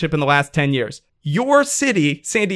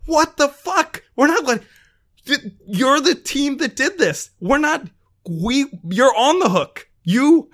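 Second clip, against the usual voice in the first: much higher, indignant, slowed and punched; outraged; 9 semitones higher than usual. Real, not acted. Podcaster blasting a Boston article to his co-host, outraged and much higher. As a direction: fire back hard at the article, outraged.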